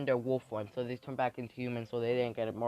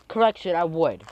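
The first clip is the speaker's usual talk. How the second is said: loud menacing retort; 4 semitones higher, 10 dB louder than usual. Acted.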